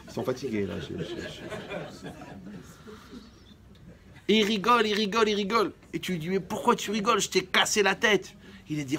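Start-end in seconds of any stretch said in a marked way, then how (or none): 0.8-2.1 petit rire imité. Retold or imitated. Imitating the man laughing. 4.3-8.3 récit animé. Acted. Mock battle, man keeps laughing.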